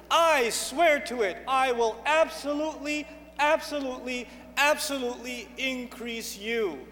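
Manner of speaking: deliberate, insistent promise; emphatic vow. Acted. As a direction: swear it with weight on every "absolutely". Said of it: "most emphatic form of language".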